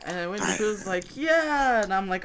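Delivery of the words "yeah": high, drawn-out cheer; eager agreement. Acted. Pooh answering the Pop-Tart offer, eager. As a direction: agree with mock delight, pitch way up.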